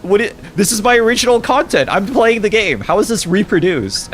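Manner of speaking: indignant protest. Real, not acted. Arguing with TikTok warning.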